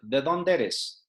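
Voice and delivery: ¿de dónde eres? slurred quickly into a single word, as locals say it; run together as one word. Shown as a demonstration. Teacher shows how locals run the question together quickly. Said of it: "they wrap it up into a single word".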